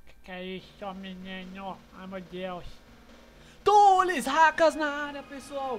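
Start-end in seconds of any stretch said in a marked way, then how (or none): 3.6-5.8 announcer intro. Acted.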